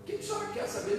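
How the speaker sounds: high polite reply. Retold or imitated. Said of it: "Eu falei".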